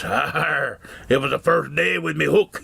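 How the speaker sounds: slow gruff pirate growl, arr. Acted. Pirate answering the curious dad, slow and growly. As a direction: play the salty pirate, drawn out. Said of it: "Guy says".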